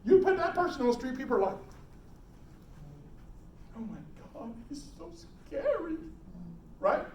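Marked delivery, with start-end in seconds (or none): 3.8-6.0 hushed mock-frightened aside; mock scared. People on the street reacting to the yeller, mock scared. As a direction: softly imitate a scared bystander. Imitating frightened onlookers, very soft.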